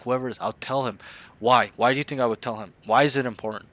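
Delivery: ordinary delivery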